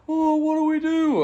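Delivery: imitating panicky friends; panicked friends. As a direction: squeal it like frightened buddies. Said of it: "They're like".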